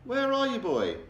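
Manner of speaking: put-on father shouting for the invisible boy; high startled shout. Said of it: "he shouts".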